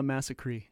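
Massacre ending in a long "cree"; odd song pronunciation. Shown as a demonstration. Host quotes the film title the singer's way, Massacre as "massa-cree". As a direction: say Massacre to rhyme with "tree". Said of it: "he pronounces it as".

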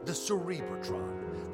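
ordinary delivery

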